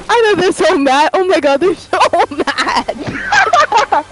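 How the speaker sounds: high voice breaking up with laughter; laughing hard. Real, not acted. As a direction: cackle helplessly through the words. Laughing at beaten opponents.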